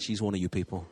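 normal talking voice

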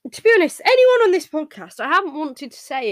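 excited. Real, not acted. Raised excited voice.

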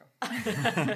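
slow, laughing through the words. Real, not acted. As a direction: chuckle through it. Laughing while talking.

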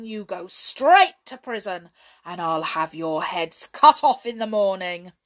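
loud, menacing royal sentence; angry threat. A narrator's reading. King threatening the brownies with prison, loud and menacing. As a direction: hammer out the threat loudly.

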